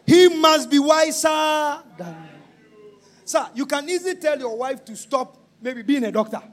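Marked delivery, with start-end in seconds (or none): 0.1-3.0 very slow, weighted words; slow emphatic plea. Real, not acted. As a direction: lean on every word.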